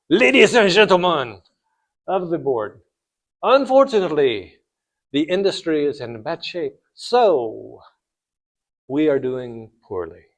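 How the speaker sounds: put-on French-accented CEO addressing his board. French CEO breaking bad news to the board, French accent. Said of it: "I will do my French accent".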